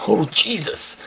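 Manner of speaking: high, startled "Oh, Jesus!"; hammed-up movie fright; mock shocked gasp. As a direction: act like the movie just scared you. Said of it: "Walt Disney wants you to be like".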